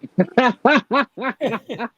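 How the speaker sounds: high, loud burst of laughter; real laughter breaking out. Real, not acted. Laughing at the story.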